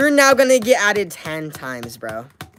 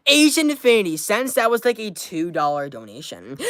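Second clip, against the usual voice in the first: ordinary talk, nothing marked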